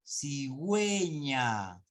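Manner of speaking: "Cigüeña" stretched, with güe as gwe; very slow word, savouring the W glide; U sounded. Shown as a demonstration. Teacher giving dieresis example, slow demonstration. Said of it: "Acá sí".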